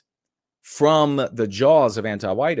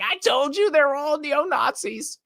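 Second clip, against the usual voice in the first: much higher mock voice, gloating; mocking whiny voice. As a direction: squeal it like a smug know-it-all. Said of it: "And she's like".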